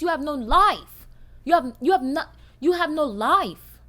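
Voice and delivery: rising outrage at hateful commenters; exasperated rant. Real, not acted. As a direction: tear into the commenters.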